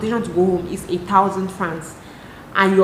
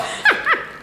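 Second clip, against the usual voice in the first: high breathy laugh. Real, not acted. Laughs about old days.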